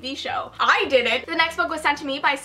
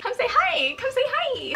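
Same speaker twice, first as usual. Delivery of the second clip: very high singsong call; high-pitched coaxing; 11 semitones higher than usual. Real, not acted. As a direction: coax your roommate over in a high voice. Calling roommate to the camera.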